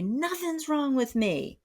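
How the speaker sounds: high, defensive snap from the firefighter part; speaking from the angry part. Retold or imitated. Wife snapping back at husband from her angry part. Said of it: "if I'm speaking from that part, I'm gonna say".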